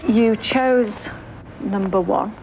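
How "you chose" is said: ordinary delivery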